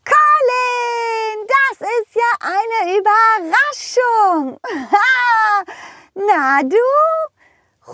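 squeaky high cartoon horse calling out. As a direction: play a cheerful high-pitched pony. Rosa the horse speaks. (hohe quietschige Pferdestimme)

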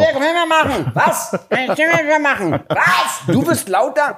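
high, loud mock girlfriend voice calling from afar; mimicking girlfriend. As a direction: play the girlfriend shouting from another room.

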